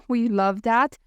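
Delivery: ordinary talk, nothing marked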